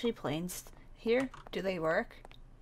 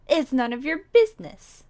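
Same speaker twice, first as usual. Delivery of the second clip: loud, higher sassy throwback; mocking retort; 5 semitones higher, 9 dB louder than usual. Girl throws the stranger's words back, loud sass. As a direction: toss his own line back at him.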